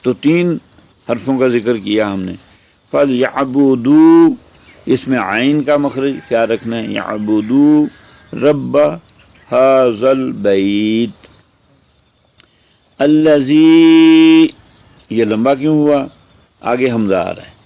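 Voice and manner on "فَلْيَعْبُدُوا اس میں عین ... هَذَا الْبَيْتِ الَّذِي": slow Quranic chant, rising on الَّذِي; teacher reciting the verse for students; Quran recitation. Acted.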